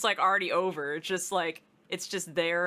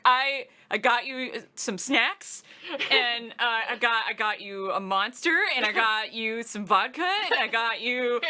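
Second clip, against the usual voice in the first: imitating eager partner; 6 semitones higher, 4 dB louder than usual. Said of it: "And you're like".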